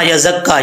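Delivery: यज़क्का said quickly, doubling not held; rushed wrong reading. Shown as a demonstration. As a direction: hurry through यज़क्का with short sounds. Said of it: "ऐसा नहीं पढ़ना".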